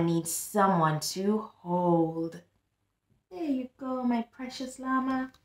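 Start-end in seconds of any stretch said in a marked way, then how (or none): 3.3-5.3 tender. Acted. Grandpa hands over the toy.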